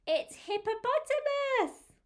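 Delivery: very high, sung-out announcement; high-pitched storybook excitement; excited reveal. Acted. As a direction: reveal the animal with great delight. Revealing the next animal.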